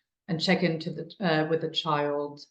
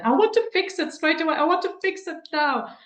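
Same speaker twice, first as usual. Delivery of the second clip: much higher, urgent mock of the eager adult; impatient fixer; 11 semitones higher, 4 dB louder than usual. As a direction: play the adult who must fix it now. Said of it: "I tried to talk them out of it".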